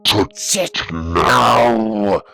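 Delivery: very slow, loud, drawn-out threat; menacing command. A narrator's reading. Ian giving David a last threat, menacing. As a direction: spell out every word menacingly.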